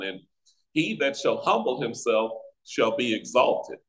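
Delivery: ordinary talk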